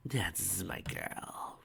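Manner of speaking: soft villainous whisper; sinister whisper. Acted. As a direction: purr approval darkly.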